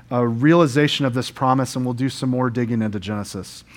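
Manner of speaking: normal talking voice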